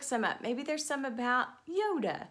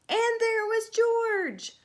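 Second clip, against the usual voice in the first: much higher, louder, slow astonished reveal; surprised reveal; 11 semitones higher, 7 dB louder, much slower than usual. A narrator's reading. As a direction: land the surprise slowly. Dramatic reveal.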